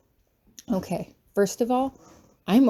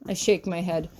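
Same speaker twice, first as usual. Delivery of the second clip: ordinary delivery, nothing marked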